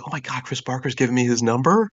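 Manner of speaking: high, excited retold fan reaction; starstruck disbelief. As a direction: gush inwardly, rising into an amazed question.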